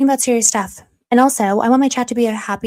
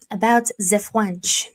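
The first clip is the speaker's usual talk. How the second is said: slow, clumsy fake French accent, "the French" as "zi fwunch"; struggling French accent; much slower than usual. Streamer introducing the topic to chat, struggling French accent. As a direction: put on a bad French accent, slowly. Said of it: "struggling through a French accent".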